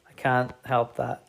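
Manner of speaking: ordinary talk, nothing marked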